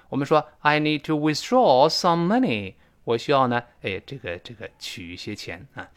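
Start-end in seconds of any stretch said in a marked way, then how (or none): 0.6-2.7 slow, careful model sentence; slow model reading. Shown as a demonstration. Teacher repeats the example sentence slowly for students. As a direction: read "I need to withdraw some money。" slowly and clearly. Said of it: "这个注意啊".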